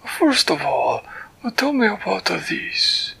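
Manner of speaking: a delighted, fussy creature greeting a friend; Flibbertigibbet's voice. Acted. Storyteller voicing Flibbertigibbet greeting Winella, high and delighted. Flibbertigibbet asks about picture.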